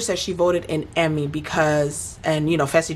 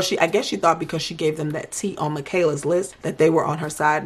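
ordinary delivery

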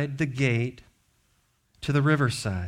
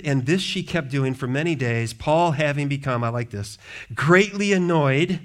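normal talking voice

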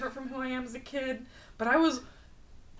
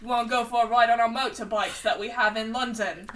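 loud, bad Cockney sliding towards Australian; botched British accent; wandering Cockney accent; 3 semitones higher, 6 dB louder than usual. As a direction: attempt Cockney and drift off into Australian. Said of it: "You went Australian and then it went somewhere else".

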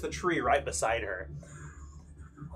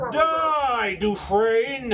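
battle cry from inside a helm, higher and louder; metallic helmet voice; 7 semitones higher, 5 dB louder, much slower than usual. Acted. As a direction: shout it through a steel visor. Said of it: "Kind of a metallic sound from beneath the helm".

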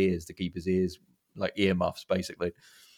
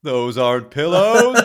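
slow, louder, higher quoted line; mock Del line; 6 semitones higher, 11 dB louder, slower than usual. Fan quoting John Candy's pillow line slowly and loudly. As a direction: deliver it slow and loud as a comic punchline.

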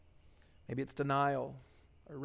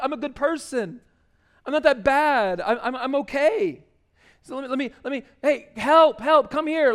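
hammed-up plea to God, loud and high; mock a desperate cry for rescue; imitating an entitled person begging God; pleading self-righteous prayer; 7 semitones higher, 7 dB louder than usual.

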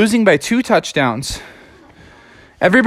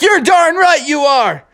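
Froggy hyped, shouting to his receiver; Froggy cheering; 10 semitones higher than usual. Acted. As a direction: celebrate the touchdown. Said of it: "And Froggy's like".

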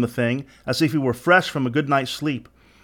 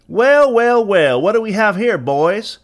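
put-on high, loud twangy young taunt; young twangy gang leader, pitched up; youthful, twangy voice; 8 semitones higher, 6 dB louder, slower than usual. A narrator's reading. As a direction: ring out like a cocky twangy youth. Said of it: "A youthful, twangy voice rang out".

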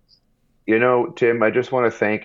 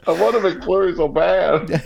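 whiny pleading; 11 semitones higher, 5 dB louder, slower than usual. Acted. Whining for ice cream.

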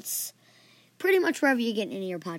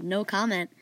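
ordinary delivery

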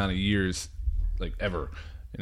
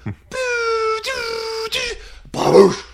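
firework noises; 4 dB louder, much slower than usual. Retold or imitated. Mimicking fireworks going off.

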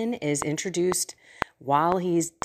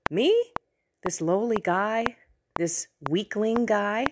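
higher, questioning disbelief, leaping up on "Me"; incredulous Gideon; 4 semitones higher than usual. Acted. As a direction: ask it like you can't believe you were picked. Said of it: "he's basically saying".

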